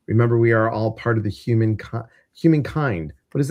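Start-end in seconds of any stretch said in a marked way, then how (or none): none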